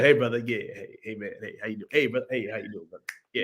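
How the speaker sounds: a hint of Mahershala's easy hey brother; imitating Mahershala. Mimicking Mahershala dancing in the film, lightly voiced soft greeting. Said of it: "Mahershala's doing the two-step".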